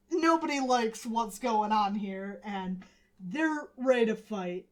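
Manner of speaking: ordinary delivery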